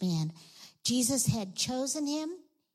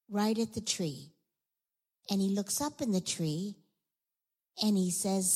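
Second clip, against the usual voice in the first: usual speaking voice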